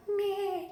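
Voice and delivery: tiny high, soft newborn cry; little rat squeak. Retold or imitated. Newborn baby's first cry imitated, little rat squeak. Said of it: "he sounded like a little rat".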